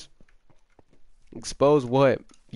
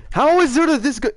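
high, loud cry of disbelief; incredulous outburst; 11 semitones higher, 5 dB louder than usual. Real, not acted. Player losing to Zerda, incredulous yell. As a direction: yell it in frustrated amazement.

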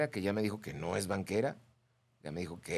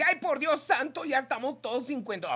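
high, loud squeaky put-on; exasperated like a fussy lady; mock listener voice; 14 semitones higher, 4 dB louder than usual. Host voicing a listener's dramatic comment in a high voice.

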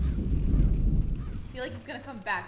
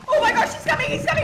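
screaming in fright, half laughing; panicked yell; 13 semitones higher, 10 dB louder, faster than usual. Real, not acted. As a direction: shriek it as the monster charges. Monster charges, panicked yell.

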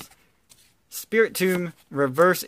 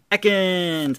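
excited call; 5 semitones higher, 3 dB louder than usual. Real, not acted. Excited calling out a card name.

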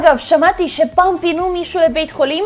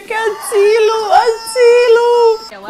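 slow, drawn-out high mock yell of help; panicked rooster cry; 6 semitones higher, much slower than usual. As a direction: wail it like a frightened rooster. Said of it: "הוא כאילו צועק". (צעקת תרנגול מבוהלת, איטית וגבוהה)

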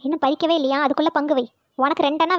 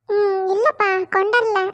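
higher-pitched, sheepish no; sheepish admission; 4 semitones higher, slower than usual. Acted. Poomari admitting to friends she has no lunch, sheepish. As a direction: own up reluctantly in a raised voice.

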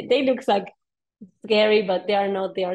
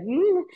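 alarmed squeak; 10 semitones higher, 3 dB louder than usual. Retold or imitated. Reacting to aggressive sea lion.

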